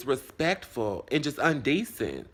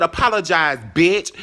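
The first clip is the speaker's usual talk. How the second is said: loud, pitched-up order to apologize; retold angry demand; 4 semitones higher, 8 dB louder than usual. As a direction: act out demanding an apology, forcefully. Acting out a demand.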